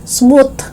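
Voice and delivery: "smooth" as "smoot"; hard T closing "smooth". Shown as a demonstration. Teacher showing learners the mistake, "smooth" with final T. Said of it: "It's not smooth".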